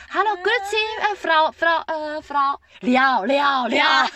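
a touch of a chirpy Swiss doctor; Swiss doctor greeting. Retold or imitated. Doctor arriving late, greets mother cheerfully, lightly voiced. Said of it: "你知道瑞士人很爱".

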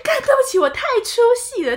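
high, laughing exclamations; cracking up at how absurd it is; laughing disbelief. Real, not acted. To her co-host about the dry costume, laughing in disbelief.

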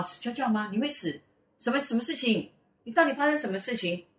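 high, incredulous questioning; acted alarm. Classmate guessing at the trouble, acted alarmed questioning. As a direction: imitate a friend demanding to understand.

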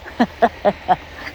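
breathless wheezing laugh; laughing fit. Real, not acted. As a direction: laugh till you lose your breath. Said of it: "岔了气". (笑到岔气)

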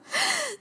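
high burst of disbelieving laughter; incredulous laugh. Real, not acted. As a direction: laugh at the absurd twist. Laughs at ironic twist. (难以置信地大笑)

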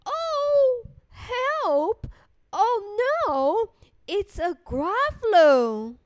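high, hammed-up cries of dismay; alarmed mouse. Mouse suddenly meets the Gruffalo, alarmed. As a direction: play a mock fright for children.

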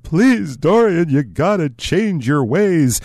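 hammed-up high, slow pleading; tearful begging. Artist begs Dorian to change his ways, mock-tearful pleading. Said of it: "he's begging him with tears".

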